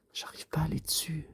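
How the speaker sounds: quick frustrated whisper; whispered grumble to herself. Real, not acted. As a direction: mutter it under your breath, fast. Frustrated aside.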